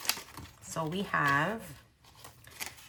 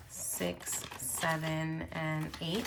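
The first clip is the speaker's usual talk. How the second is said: counting under breath; 6 dB quieter, much slower than usual. Real, not acted. Counting money quietly to herself.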